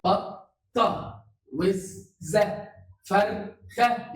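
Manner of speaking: فرخة said slowly and clearly, syllable by syllable; slow word example. Shown as a demonstration. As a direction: spell out فرخة slowly for a child. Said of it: "كلمة من مقطعين". (نطق كلمة فرخة ببطء ووضوح)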